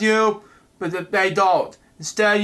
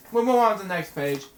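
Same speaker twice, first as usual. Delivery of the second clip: ordinary talk, nothing marked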